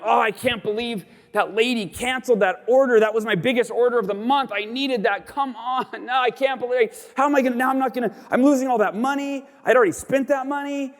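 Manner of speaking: high, fast, exasperated self-pity with laughs; mock frustrated griping. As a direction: ham up your own whining about the lost sale. Retold self griping over canceled order.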